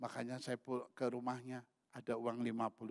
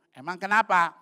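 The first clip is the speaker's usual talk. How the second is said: loud, raised-pitch retort; acted startled question; 6 semitones higher, 13 dB louder than usual. Husband questioning his wife in a retold exchange, loud and high. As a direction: fire back the question loudly.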